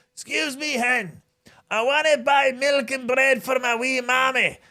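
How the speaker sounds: put-on very high-pitched old Glasgow man; wee old man. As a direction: put on a squeaky wee man asking the inspector, drawn out at the end. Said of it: "and this wee man".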